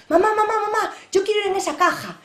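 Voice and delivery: put-on high, loud child voice tugging at his mother; excited little boy. Boy tugging his mother's hand, high and eager. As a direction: pester like a small boy who wants something. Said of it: "y a decirle".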